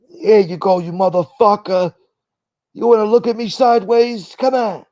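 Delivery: tough guy threat. Acted. Acting a mobster daring a rival, hammed-up taunt. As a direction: taunt him like a street tough.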